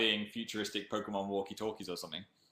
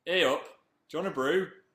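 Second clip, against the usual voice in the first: Hey up and brew in a broad Northern accent, higher and louder; 6 semitones higher, 4 dB louder than usual. Shown as a demonstration. Fan asks for brew, broader Northern. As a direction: really lay the Northern accent on. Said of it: "Say brew".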